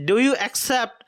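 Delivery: ordinary talk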